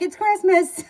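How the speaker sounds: high, loud family-joke holler; mock yell. Brother greeting sibling on Christmas, imitating mom's yell. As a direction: brother hammering out the running joke as a shout. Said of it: "he'll be like".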